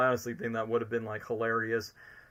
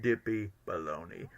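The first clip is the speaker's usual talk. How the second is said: dropping, softer villain scoff; Lord Business; 3 semitones lower, 5 dB quieter than usual. Retold or imitated. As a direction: scoff it in a lightly put-on villain voice. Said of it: "what Will Ferrell's Lord Business said".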